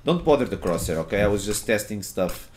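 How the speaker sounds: ordinary talk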